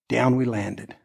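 usual speaking voice

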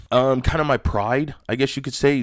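usual speaking voice

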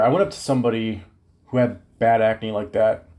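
ordinary talk, nothing marked

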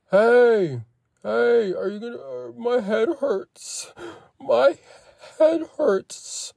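high, whiny imitation of her complaining; high whining girlfriend impression; mock whiny girlfriend. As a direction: mimic her whimpering about her head. Girlfriend whining after hitting head.

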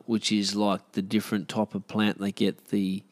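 ordinary delivery

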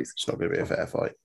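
breathy echoed whisper. Pretend. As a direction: whisper it back like a secret.

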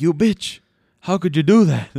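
loud, high outraged yell; angry yell. Retold or imitated. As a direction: shout it, betrayed and furious. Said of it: "And he's like".

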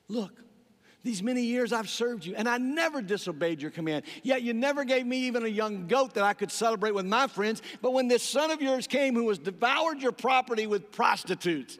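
loud, high resentful complaint; ticked off. Acted. Older brother complaining to his father, loud and angry. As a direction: throw the grievance at his father. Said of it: "Yeah, he is ticked off".

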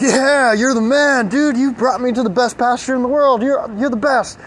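voiced high and bright with delight, a happy horse; excited horse. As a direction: thank him like he's your hero.

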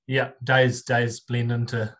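ordinary delivery

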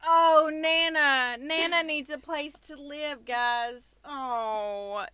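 hammed-up sympathy plea, stretching the words; mock pleading. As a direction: beg pitifully on Nana's behalf, in jest. Playacts pleading for a borrowed grandmother.

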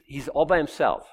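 ordinary talk, nothing marked

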